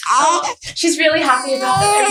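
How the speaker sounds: high, slow, tearful disbelief; overwhelmed friend crying with joy. Real, not acted. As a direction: let the happy tears break the words. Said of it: "What's my excuse?".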